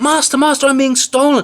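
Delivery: very high, loud cry; harp calling out. A narrator's reading. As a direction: shriek for the master. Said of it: "the harp called out".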